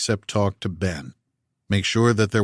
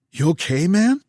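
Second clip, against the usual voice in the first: put-on smooth Californian accent; 10 semitones higher, 4 dB louder than usual. A narrator's reading. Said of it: "in a smooth Californian accent with just a hint of Louisiana".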